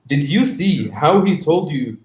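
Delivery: normal talking voice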